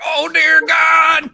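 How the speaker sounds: high, loud, slow frightened shout; panicked cry. Retold or imitated. Storyteller voicing Dan's fright at the noise, a loud panicked cry. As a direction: cry out in alarm at the crash.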